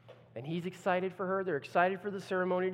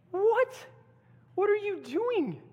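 very high, loud outrage; shocked bride; 6 dB louder, slower than usual. Acted. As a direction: shriek it in disbelief.

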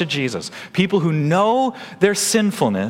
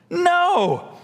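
sharp high no; flat denial, pitched way up; emphatic no; 14 semitones higher than usual. Real, not acted. Pastor answering the congregation, emphatic high no. Preacher answers his own question.

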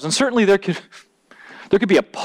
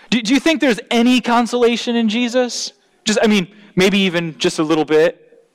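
mock doubtful question; 9 semitones higher than usual. Tongue-in-cheek question on Paul's hyperbole.

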